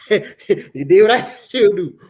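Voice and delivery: slow words broken up by laughter; laughing. Real, not acted. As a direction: laugh through the line. Laughing at wife's hot dogs.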